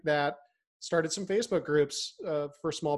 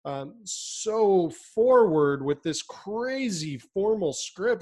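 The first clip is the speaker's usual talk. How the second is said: usual speaking voice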